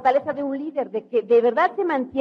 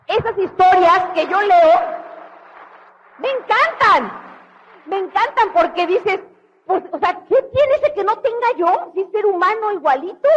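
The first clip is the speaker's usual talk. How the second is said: much higher and louder with enthusiasm; getting excited; 6 semitones higher, 4 dB louder than usual. Real, not acted. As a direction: let the excitement run away with you. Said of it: "me emociono".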